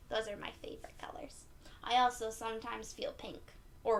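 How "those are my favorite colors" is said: quick whispered aside. Real, not acted. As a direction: whisper it fast, like a secret. Aside while sharing colors.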